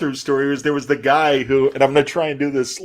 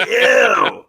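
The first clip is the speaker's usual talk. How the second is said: disgusted shout; 11 semitones higher, 4 dB louder than usual. Retold or imitated. Disgusted reaction to gross story.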